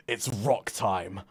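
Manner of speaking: slow, drawn-out hero line; put-on tough-guy voice, drawn out; action hero one-liner. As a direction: deliver it like a movie catchphrase. The Rock's catchphrase on phone.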